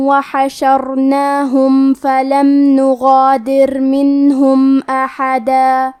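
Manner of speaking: slow, melodic tilawat; solemn chanted Quran recital; Quran recitation. Shown as a demonstration. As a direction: recite the verses slowly with measured tajweed. Teacher recites the verses.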